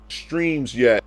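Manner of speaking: normal talking voice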